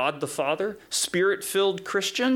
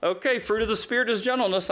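flustered; 7 semitones higher than usual. Retold or imitated. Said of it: "I'm like".

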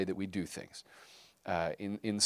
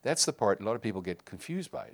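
ordinary talk

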